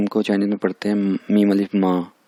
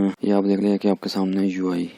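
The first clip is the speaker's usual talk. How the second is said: ordinary delivery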